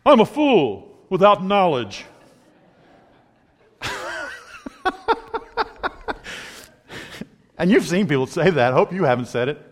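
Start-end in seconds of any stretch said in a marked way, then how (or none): 0.0-2.0 slow, higher-pitched dopey voice; a slow put-on fool; foolish voice. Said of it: "He goes". 3.8-6.5 high squeal of laughter; high squeal laughing at his joke. Real, not acted.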